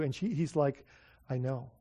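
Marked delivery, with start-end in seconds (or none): 1.3-1.7 low, soft retold answer; subdued reply. Shop owner's son answering the preacher, subdued. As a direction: drop it to a quiet acknowledgment.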